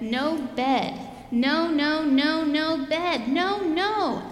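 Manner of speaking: loud, high whining no's; tantrum. Acted. Bella refusing to go to bed, loud high tantrum. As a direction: stretch out the no's like a child fighting bedtime. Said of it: "rolled and rolled and said".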